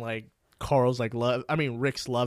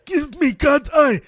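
movie quote impression; 12 semitones higher, 7 dB louder, slower than usual. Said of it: "that guy".

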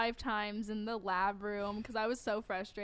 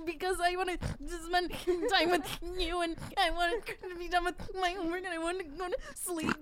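high, gasping mock crying; hyperventilating; 6 semitones higher than usual. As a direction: act out sobbing between gulps of air. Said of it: "through the hyperventilating thing where I'm like".

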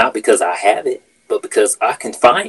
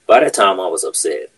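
normal talking voice

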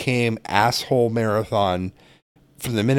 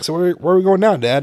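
put-on kid asking; 7 semitones higher, 4 dB louder than usual. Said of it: "And Trent's like".

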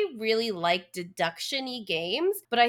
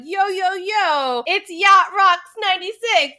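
imitating a hyped influencer, slow, high and loud; 8 semitones higher, 7 dB louder than usual. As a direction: call out a drawn-out streamer intro. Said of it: "the quote-unquote influencer accent".

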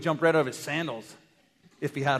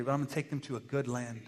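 ordinary talk, nothing marked

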